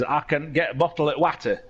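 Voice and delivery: West Yorkshire accent, short flat "a" in "water"; Yorkshire accent, "water" said as spelt. Shown as a demonstration. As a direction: say it as a West Yorkshire local would. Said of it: "I'm gonna do it in Yorkshire accent".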